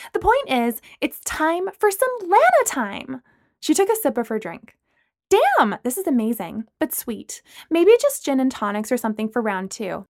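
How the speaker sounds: chirpy and curious; Lana. Acted. Lana asking Brooklyn about the drink.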